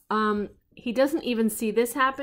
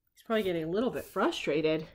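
ordinary delivery, nothing marked

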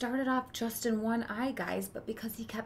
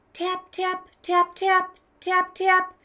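higher, crisp tap tap; cane taps; 9 semitones higher, 10 dB louder, slower than usual. Acted. Boy counting steps with his cane, crisp taps. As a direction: tick each tap like a cane on stone.

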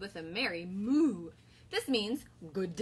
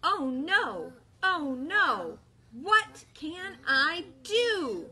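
worried cow; 4 semitones higher, 5 dB louder, much slower than usual. Acted. Bella's lament, children join.

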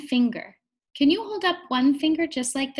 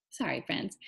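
quick soft whisper aside; whispered apology; 8 semitones lower, 10 dB quieter, faster than usual. Real, not acted. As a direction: murmur a sorry under your breath. Apologising after stumbling mid-sentence.

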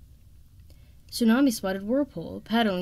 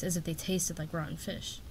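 ordinary delivery, nothing marked

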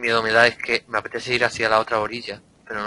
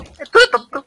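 high, loud, disbelieving shout; incredulous yell; 6 dB louder, slower than usual. Real, not acted. Yells at friend Chirac.

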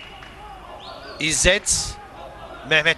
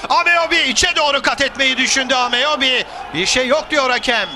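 excited commentary; 6 semitones higher than usual. Real, not acted. Attack near the box, call.

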